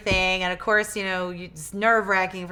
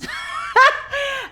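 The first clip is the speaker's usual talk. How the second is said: loud high laugh; sudden loud cackle; burst of laughter; 6 dB louder than usual. Real, not acted. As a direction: laugh out loud at your own joke. Laughs at own joke.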